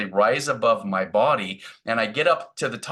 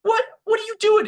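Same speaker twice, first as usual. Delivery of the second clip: startled; 5 dB louder than usual. Retold or imitated. Startled by spirit guide's arm.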